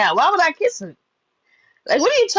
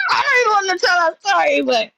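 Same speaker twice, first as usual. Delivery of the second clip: laughing; 8 semitones higher than usual. Real, not acted. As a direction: laugh out the line, embarrassed. Laughing about wild story.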